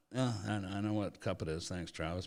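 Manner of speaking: deadpan mutter of thanks; dry sarcastic retort. Retold or imitated. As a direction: throw it away under your breath. Host's dry retort to Travis.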